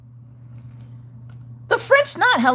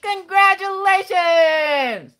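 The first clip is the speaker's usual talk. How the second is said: stretched cheer; 13 semitones higher, much slower than usual. Real, not acted. Cheers a viewer's wedding.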